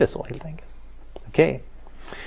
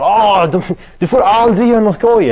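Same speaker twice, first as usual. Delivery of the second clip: much higher, louder teasing exaggeration; mocking friends; 8 semitones higher, 7 dB louder than usual. Friends on the phone mocking Dan for never going out, loud and high. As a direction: whine it like scoffing teenage friends. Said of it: "De överdriver".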